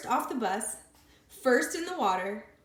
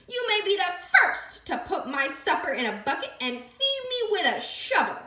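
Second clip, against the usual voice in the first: high, loud, gloating creature voice; sandwich creature; 9 semitones higher, 6 dB louder than usual. Acted. Creature bossing the captured piglet. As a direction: gloat over her catch.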